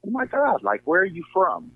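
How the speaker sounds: high-pitched imitation of amazed passengers; retold astonishment. Passenger quizzing the Uber driver on origins, retold astonishment.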